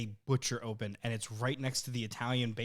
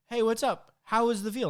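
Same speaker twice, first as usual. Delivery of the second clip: higher, louder friendly greeting; cheery butcher; 9 semitones higher, 5 dB louder than usual. Retold or imitated. Retelling the butcher's greeting, higher and louder. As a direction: greet like a chummy shopkeeper.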